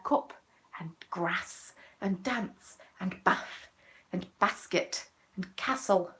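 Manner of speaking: slow, spaced-out words in a Nottingham accent; northern English pronunciation. Shown as a demonstration. Southerner showing viewers how Nottingham says it, northern accent. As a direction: say grass, bath and castle with short northern vowels. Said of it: "'Cause they say things like".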